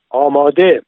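loud military order; commanding call. A narrator's reading. Sergeant readying soldiers facing the villagers, commanding call. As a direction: call it out to the whole rank.

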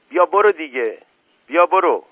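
high, loud shooing; shouting. A narrator's reading. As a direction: shout to drive someone off. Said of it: "صالح کمزاری هم داد زد". (با داد و صدای بلند راندن)

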